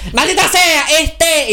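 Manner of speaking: high exasperated cry of maldita sea; frustrated outburst. Retold or imitated. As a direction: blow up in frustration. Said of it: "mis ganas de pegarle un coñazo".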